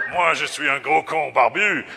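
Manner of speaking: put-on loud, high French accent; outrageous French accent. Cartoon artiste's revised frame, loud French accent. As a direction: declaim it in a hammed-up French accent. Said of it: "He speaks with an outrageous French accent".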